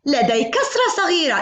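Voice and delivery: ordinary talk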